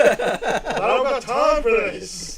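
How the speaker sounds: loud, high, slow exasperated shout; impatient guard. Acted. Guard slaps the big fella's arm, shouting, laughing. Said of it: "he just picks one of your arms".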